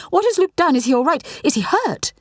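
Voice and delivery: high, panicked questions; frantic. A narrator's reading. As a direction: ask in rising panic. Frantic mother about son.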